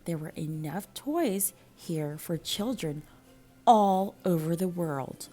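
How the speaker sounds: ordinary delivery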